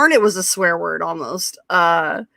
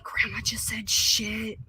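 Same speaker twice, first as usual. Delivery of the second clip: slow, hushed mock shock; retold horrified whisper; scandalized whisper; 10 dB quieter, slower than usual. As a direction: whisper it slowly like forbidden news. Retold scandalized aside to family.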